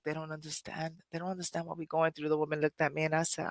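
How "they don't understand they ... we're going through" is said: mother quietly confiding to a stranger; hushed confiding. Retold or imitated. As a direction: murmur it so the kids won't hear.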